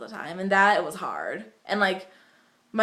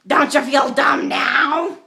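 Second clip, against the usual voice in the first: mocking teacher; 5 semitones higher, 9 dB louder, much slower than usual. Teacher mocks student at conference.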